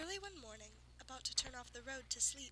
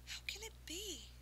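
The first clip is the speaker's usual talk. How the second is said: wondering; 8 semitones higher, 4 dB quieter than usual. Acted. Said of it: "she wondered".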